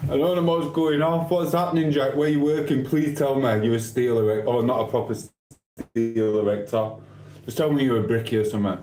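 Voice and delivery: ordinary delivery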